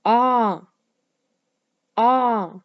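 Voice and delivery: a loud open ah, twice; ah sound. Shown as a demonstration. Teacher leading the class in the ah sound. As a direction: call out ah for the kids to echo. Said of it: "Can you say the ah sound with me".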